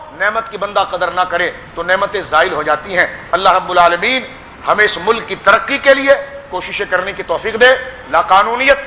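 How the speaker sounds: normal talking voice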